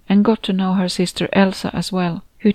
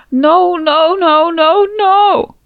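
high, loud anguished wail; howling grief; 11 semitones higher, 7 dB louder, much slower than usual. Acted. Grieving mother howls at her daughter's death, anguished. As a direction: howl like a wounded animal. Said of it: "A howl like a wounded animal".

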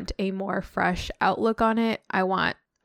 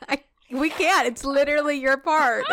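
laughing; 8 semitones higher than usual. Real, not acted. Laughing at friend's sneezes.

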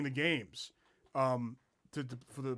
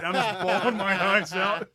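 laughing; 5 semitones higher, 8 dB louder, slower than usual. Real, not acted. Laughing while retelling crying.

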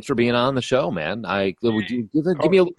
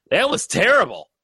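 loud blunt verdict; 10 semitones higher, 4 dB louder than usual. Retold or imitated. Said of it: "she starts talking louder because she can't".

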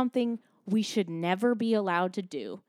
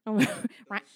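usual speaking voice

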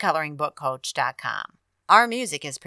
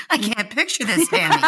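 laughing; 9 semitones higher than usual. Real, not acted. Laughing at friend's story.

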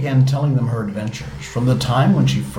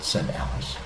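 ordinary delivery

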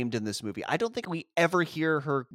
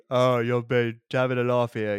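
louder dismissive conductor voice; lightly voiced brush-off from a station worker; mock train conductor; 5 dB louder than usual. Conductor brushing off a lost child, mock dismissive. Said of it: "and he's like, you know".

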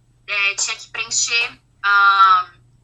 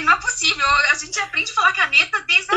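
higher, worked-up disbelief; a wife venting on the plane that she can't say caneta; incredulous rant; 6 semitones higher than usual. Retold or imitated. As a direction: complain to the husband that this can't be happening.

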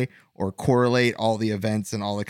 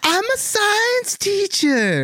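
very high, slow whiny squeak; mock earnest film hero, hammed up; bad-actor parody; much slower than usual. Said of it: "me going like this".

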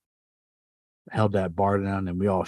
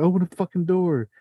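high, pressed mock panic; frantic retold demand; 9 semitones higher, 4 dB louder than usual. Teen hearing footsteps, ordering his friend to open the door in high panic. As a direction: replay the scared order to open up.

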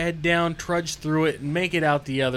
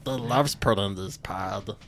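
lower, rasping creature croon; Smeagol voice; 7 semitones lower than usual. Acted. Friend asks for the voice again, lower Smeagol croon. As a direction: purr it like a hunched goblin. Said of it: "Come on, Schmeagol. Let me hear it again".

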